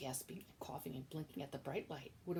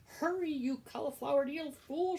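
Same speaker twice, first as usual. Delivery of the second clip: high, loud shout; shouted insult; 10 semitones higher, 10 dB louder than usual. Acted. Cook shouting at Midge to hurry, high loud shout. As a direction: bellow the insult. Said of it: "shouted the cook".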